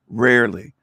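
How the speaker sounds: normal talking voice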